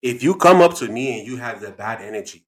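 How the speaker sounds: put-on emphatic African-accented lecture. Dad explains his treatment rule, African accent. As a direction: build the argument in an African accent. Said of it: "African accent".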